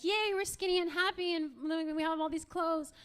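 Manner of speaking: high, chirpy mock cheer; gleeful skinny girls. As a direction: mimic happy little mall girls. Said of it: "all the little skinny girls are like".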